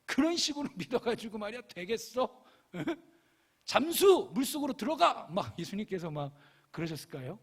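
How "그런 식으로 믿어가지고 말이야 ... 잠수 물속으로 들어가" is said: high-pitched mock-irritated nagging, building to loud orders; exasperated telling-off ending in a shouted command; annoyed scolding. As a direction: huff and scold in exaggerated annoyance. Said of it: "막 짜증내면서 막".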